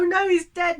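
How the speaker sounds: lightly hammed-up grief; mock emotional. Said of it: "it's all emotional and very sort of".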